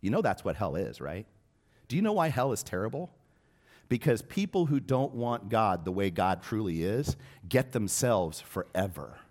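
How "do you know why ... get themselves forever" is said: higher, urgent preacher's push; fervent preaching. Acted. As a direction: drive the point home to the congregation. Preaching at full force.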